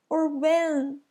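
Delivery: sobbing. Acted. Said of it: "he sobbed".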